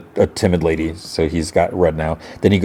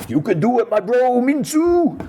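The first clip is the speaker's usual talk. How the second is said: Thanos. Retold or imitated. Imitating dead Thanos to goad timid Minsu, high hammed-up impression. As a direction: squeal it in a put-on, far higher voice. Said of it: "he imitates Thanos".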